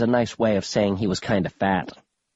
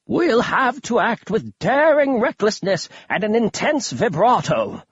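put-on higher, theatrical old man voice; eccentric old grandpa; 8 semitones higher than usual. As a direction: play a dotty, thrilled old grandpa.

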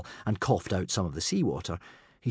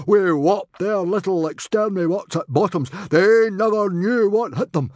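loud, much higher booming chieftain; roaring proud chief, much louder; hearty Viking chief; 7 semitones higher, 8 dB louder than usual. A narrator's reading. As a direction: boast like a proud rowdy chief. Stoic the chief's voice.

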